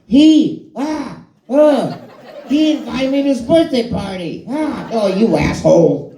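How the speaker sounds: very high, giggly Fallon voice bursting with news; Jimmy Fallon. Retold or imitated. Fallon on the phone jealous about the party, high and giggling. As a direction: squeal it like an overexcited friend. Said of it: "Jimmy Fallon impression".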